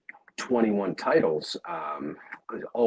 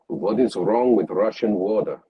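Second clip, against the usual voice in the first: put-on Russian accent, a bit louder, drilling the line; Russian accent warm-up; 4 dB louder than usual. Shown as a demonstration. Narrator demoing an accent warm-up to the interviewer, Russian accent. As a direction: rehearse the phrase in a Russian accent as a vocal warm-up. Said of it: "did the warm-ups".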